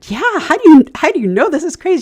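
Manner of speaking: high, loud burst of disbelief; acted-out stunned excitement; retold amazement. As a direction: blurt it out, astonished, voice leaping up. Retold amazed reaction to stranger's insight.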